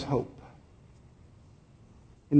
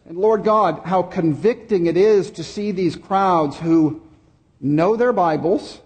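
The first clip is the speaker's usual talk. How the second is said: slow, raised, earnest prayer voice; praying aloud on Palm Sunday; pastoral prayer; 4 semitones higher than usual. Real, not acted. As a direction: lift the congregation's prayer to God. Leads a prayer.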